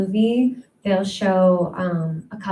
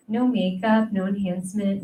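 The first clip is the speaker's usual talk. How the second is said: ordinary talk, nothing marked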